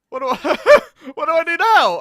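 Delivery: high, loud panicked laughing question. Real, not acted.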